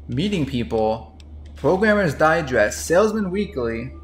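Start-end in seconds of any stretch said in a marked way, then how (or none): none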